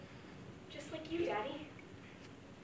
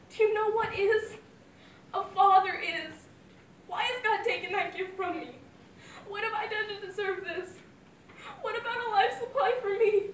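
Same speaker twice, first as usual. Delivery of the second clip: tearful outcry; 7 semitones higher, 9 dB louder than usual. Acted. Daughter cries out to God.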